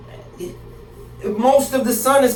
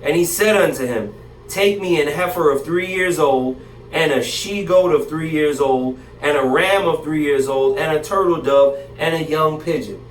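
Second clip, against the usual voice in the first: lower, measured King James reading voice; steady Bible reading, voice a little lower; scripture reading; 5 semitones lower than usual. Acted. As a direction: read the old verses out steadily. Reads scripture aloud.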